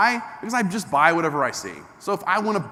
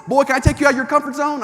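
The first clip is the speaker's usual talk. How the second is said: coach asking permission; 9 semitones higher, 5 dB louder, faster than usual. Retold or imitated. Instructor asking the driver's permission, louder and higher. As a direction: press him for a yes.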